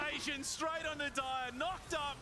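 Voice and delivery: high, fast, excited fight call; live play-by-play. Acted. Caster calling the team fight live.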